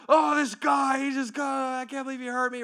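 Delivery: high, heated outburst starting loud; acted blow-up. Venting to friends about being hurt, acted exploding complaint. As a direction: blow up in mock exasperation. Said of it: "I blow up on them".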